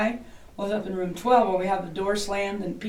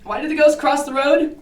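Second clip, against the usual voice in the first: joke setup; 6 semitones higher, 5 dB louder than usual. Pretend. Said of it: "but I'm a comedian".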